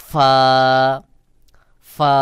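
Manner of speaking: Arabic ফা as a long lip-to-teeth f; airy ফা. Shown as a demonstration. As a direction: hold ফা, air pushed outward. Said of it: "বাতাস বের হবে".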